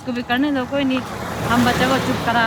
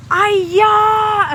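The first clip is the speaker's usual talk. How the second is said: sharp, high drawn-out "आया" to show annoyance; irritated snap; 10 semitones higher, 8 dB louder, much slower than usual. Retold or imitated. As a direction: bite out "आया" like an irritated nurse. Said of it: "वो nurse ना irritate से". (चिढ़कर ऊँची खिंची "आया")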